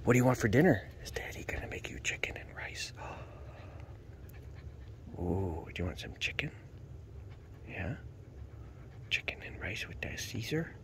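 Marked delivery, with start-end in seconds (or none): 1.0-6.5 whisper. Real, not acted. Whispered to dog. 7.7-10.7 whisper. Real, not acted. Whispered to dog.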